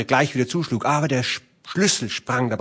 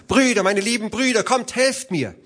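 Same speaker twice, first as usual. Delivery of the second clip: high-pitched narrated cry for help; Hilfeschrei; 10 semitones higher than usual. A narrator's reading. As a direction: cry out for your brothers, voice high. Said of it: "Da schrie sie noch einmal". (hoher, gespielter Hilfeschrei)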